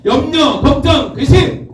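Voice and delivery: high, punchy call to the crowd; each word pitched high; rallying call. Real, not acted. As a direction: cue the congregation to shout back. Cueing congregation to shout back.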